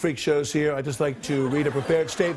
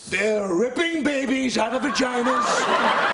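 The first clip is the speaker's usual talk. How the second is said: outraged shout; 9 semitones higher, 3 dB louder, slower than usual. Acted. Shouted campaign outburst.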